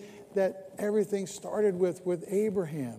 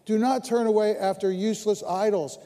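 louder, proclaimed; preached scripture; 4 semitones higher, 7 dB louder than usual. Acted. Pastor reading Samuel to the church, proclaimed louder. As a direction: proclaim the verse to the room. Reads Samuel's words in the sermon.

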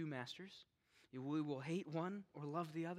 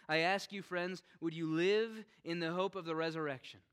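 fervent call at full voice; loud preaching; 4 semitones higher, 8 dB louder than usual. Real, not acted. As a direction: press the question hard. Repeated call louder.

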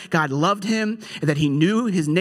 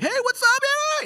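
squealed falsetto mock greeting; over-eager greeter. Preacher mimicking the outgoing type he is not, over-eager greeter. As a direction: put on a very high, hyped-up hello. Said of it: "I'm not like the".

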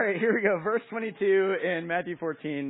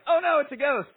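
high, loud cry of alarm; hammed-up frightened outburst; mock panic; 8 semitones higher, 9 dB louder than usual. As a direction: yelp it out like spooked disciples. Disciples' panic acted out.

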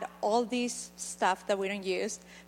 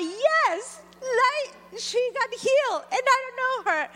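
excited; 13 semitones higher than usual. Retold or imitated. Retells her joy at healing.

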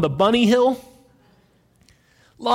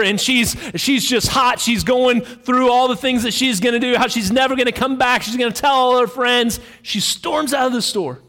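ordinary talk

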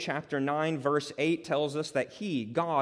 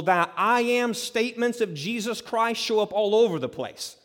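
preaching at full force; 6 semitones higher, 8 dB louder than usual. Acted. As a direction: proclaim each I am to the congregation. Preaching climax on I Am.

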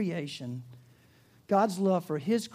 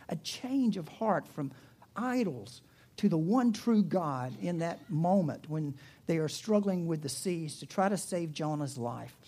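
ordinary talk, nothing marked

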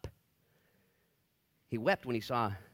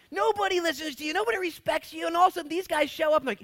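much higher, louder preaching cry; full-force preaching; 12 dB louder than usual. Acted. Preacher firing up the church about authority, full-force shout. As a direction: preach it at the top of your voice.

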